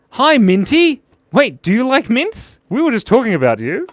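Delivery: high, loud, delighted hello; excited greeting. Real, not acted. Streamer greeting a viewer just talked about, excited and high. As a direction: squeal hello to a favourite arrival.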